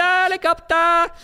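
high and drawn-out, mocking the word helicopter; mock squeaky high helicopter. Shown as a demonstration. Mocking the helicopter idea to his co-host, high and slow. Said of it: "Helicopter (repeated)".

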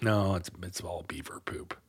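soft unvoiced whisper. Real, not acted. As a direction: hush it like a secret. Joking hushed reply.

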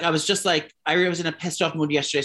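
usual speaking voice

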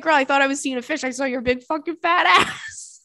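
high, hurried mock exclamation; friend's outcry. Friend looked underwater expecting fish, imitated outcry. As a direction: imitate a scandalized friend, building to the end. Acts friend's shocked reply.

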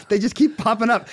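usual speaking voice

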